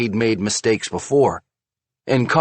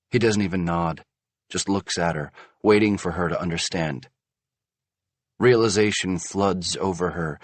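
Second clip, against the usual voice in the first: plain reading voice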